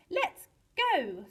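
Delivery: high, loud call to leave; rallying call. A narrator's reading. Colin hurries the rodents to the balloon, rallying. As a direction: call the group to move off. Colin's pad urges departure.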